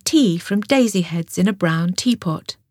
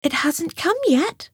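higher, mild gentle voice; Grey Rabbit answering Squirrel, higher and gentle; 10 semitones higher than usual. A narrator's reading. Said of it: "she said".

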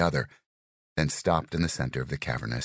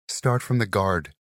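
slow, firm instructor call; instructor command; 6 semitones higher, 4 dB louder than usual. Acted. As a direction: call it out to the class. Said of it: "she said".

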